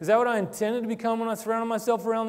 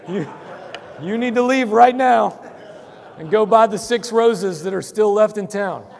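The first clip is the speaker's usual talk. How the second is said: mock urgent warning; 3 semitones higher, 9 dB louder than usual. Joking warning to forgetful husbands.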